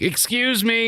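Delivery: high, grating catchphrase; Vicky Guerrero. Retold or imitated. Host showing how she sounds on the mic, very grating. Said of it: "like, very grating".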